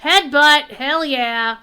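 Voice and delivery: loud, pumped-up hell yeah; excited cheer. Real, not acted. Gamer cheering a new skill, loud and excited.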